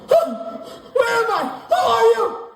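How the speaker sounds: high-pitched panicked shriek; startled awake. Acted. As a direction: cry out as if waking up kidnapped.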